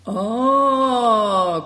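long, loud, high "ah" of realisation; acted loud exclamation; cried out. A narrator's reading. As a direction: let out a drawn-out high cry. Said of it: "cried Greencoat".

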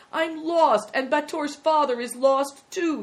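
high, loud, despairing lament; wailing. Acted. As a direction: wail it out like a lost boy. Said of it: "he wailed".

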